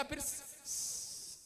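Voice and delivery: stuck on s, slow and breathy; stammering husband. Retold or imitated. Poor stammering husband answering his wife, stuttering. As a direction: stumble over the first sound. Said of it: "तोतला था बेचारा".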